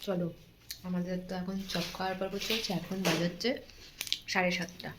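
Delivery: quiet, hushed vlog chat; speaking softly. Real, not acted. Sleepy vlogger signing off beside the sleeping little one, speaking softly. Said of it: "আস্তে আস্তে কথা বলছি".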